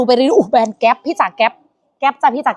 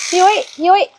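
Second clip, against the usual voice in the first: calling out; 5 semitones higher than usual. Real, not acted. Calling to the boss.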